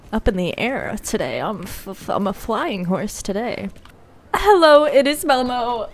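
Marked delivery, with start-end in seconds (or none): 4.3-5.9 excited hello. Real, not acted. Excited greeting to viewers.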